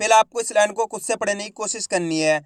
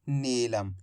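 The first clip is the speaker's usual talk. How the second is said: soft low reveal; 9 semitones lower, 10 dB quieter, much slower than usual. Shown as a demonstration. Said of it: "तो मिलके बन जाएगा".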